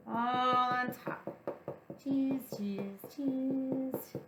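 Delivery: ordinary delivery, nothing marked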